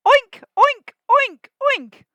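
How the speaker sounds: high, loud hen-pig oinks; oinking cry. Acted. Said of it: "all the hens cried in reply".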